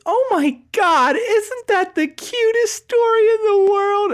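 very high, slow, swooning squeal; gushing. Retold or imitated. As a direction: coo over the cutest story ever. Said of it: "the lady goes".

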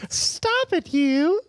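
a lightly voiced lonely princess; dreamy princess voice. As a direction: sigh it out like a captive princess. Plays trapped princess.

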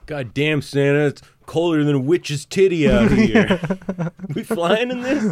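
complaining Canadian reindeer, climbing in pitch; put-on Canadian grumble; Canadian accent. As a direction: gripe in a Canadian accent, rising to disbelief. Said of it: "very Canadian accent?".

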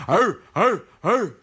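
imitated boot-camp "arr"; Marine war-cry barks. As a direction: bark like a drilled recruit. Mimics Marines' grunted war cry.

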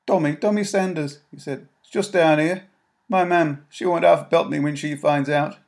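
a nervous lad from the streets; boy's voice. Acted. Tommy answering Mary, high and nervous.

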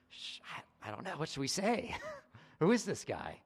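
mock confused disciples, half whispered then a laugh; flustered disciples. Imagining disciples stumped by Jesus' question, mock flustered. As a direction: stammer like caught-out students. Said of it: "the disciples like".

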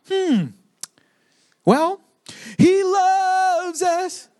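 high, drawn-out mock reassurance; evasive non-answer. Church dodging hard issues in society, evasive high drawl. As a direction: dodge sweetly, stretching the words out high.

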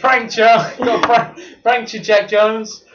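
loud, high gloating yell; imitating a prankster shouting his catchphrase; mock prankster gloat. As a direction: crow it at him like a prank star. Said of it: "put a pizza in his face and say".

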